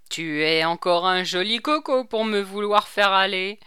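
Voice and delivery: loud, indignant retort; irate, forceful comeback; angry. A narrator's reading. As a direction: scoff angrily at the order. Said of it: "la colère du commandant".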